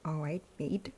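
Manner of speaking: attempted Australian accent; roughly Australian-sounding English. Shown as a demonstration. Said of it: "Doesn't that sound kind of Australian?".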